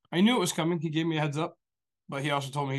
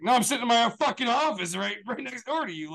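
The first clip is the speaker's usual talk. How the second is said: mock indignant retort; 8 semitones higher, 3 dB louder than usual. Retold indignant reply to gossiping coworkers.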